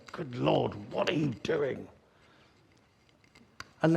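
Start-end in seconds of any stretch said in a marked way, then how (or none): 0.1-1.9 muttered, softer exasperated prayer; under the breath. Retold or imitated. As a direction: grumble it quietly to God under your breath. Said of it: "under my breath".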